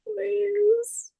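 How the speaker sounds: high, mock-pitiful plea; whiny pleading. Joking with the group, she begs high for electrolytes.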